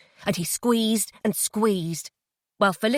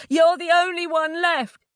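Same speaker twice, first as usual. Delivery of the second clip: desperate plea; 9 semitones higher, 4 dB louder than usual. Acted. Crying captor pleads.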